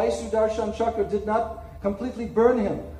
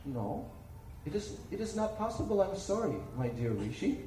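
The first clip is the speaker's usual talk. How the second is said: much lower and softer, tender but final; gentle divine refusal; 3 semitones lower, 10 dB quieter than usual. Acted. Lord Vishnu softly telling the rishi he cannot save him. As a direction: turn him away kindly, almost hushed.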